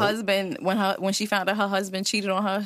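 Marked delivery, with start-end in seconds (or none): none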